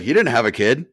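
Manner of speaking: loud exasperated retelling. Retold or imitated. To the crowd, retelling the coach's correction loudly through laughter. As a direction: blurt it out while the room laughs. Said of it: "He's like".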